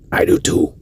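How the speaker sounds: unvoiced conspiratorial whisper; whispered secret. Rock star confiding his secret, in a whisper. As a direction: breathe it like a confession. Secret shared on tour bus.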